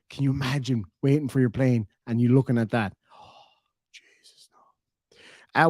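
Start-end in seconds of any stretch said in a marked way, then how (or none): 3.1-5.7 breathy whisper of horror; whispered Jesus, no; whispered dread. Pretend. Dreading the seat next to him.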